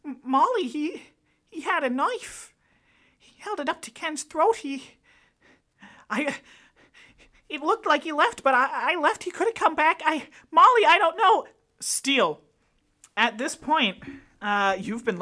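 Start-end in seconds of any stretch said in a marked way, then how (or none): 0.1-11.5 high, shaky-voiced panic; frantic witness pleading; panicked witness. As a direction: blurt it out in a frightened rush.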